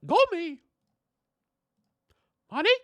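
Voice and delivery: high squeaky cartoon mouse; Mickey Mouse. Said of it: "I can't do a very good Mickey".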